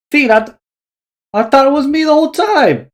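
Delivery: high, loud emotional cry; emotional outburst. Acted. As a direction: blurt out a heartfelt confession. Emotional brotherly reunion.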